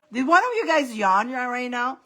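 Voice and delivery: ordinary talk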